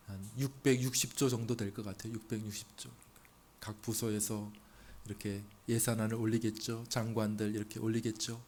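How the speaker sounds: ordinary talk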